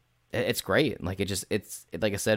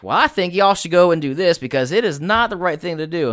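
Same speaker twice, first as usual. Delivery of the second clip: higher, louder put-on adventurer voice; role-play character voice; 8 semitones higher, 6 dB louder than usual. Player speaking in character to the group, put-on voice. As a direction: ham up a character to the party. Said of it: "Why are you talking like that?".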